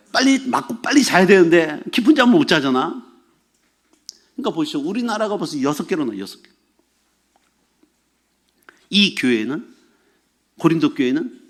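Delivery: ordinary delivery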